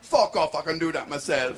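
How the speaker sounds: put-on indignant Jamaican-accented retort. Chalky turns down her offer, Jamaican accent. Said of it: "This is my Jamaican accent now".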